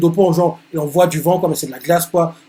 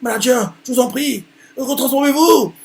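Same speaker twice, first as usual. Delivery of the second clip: high pleading voice; secretary begging her boss in a high voice; 9 semitones higher, 4 dB louder than usual. Acted. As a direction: beg the manager to change back. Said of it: "La secrétaire fait".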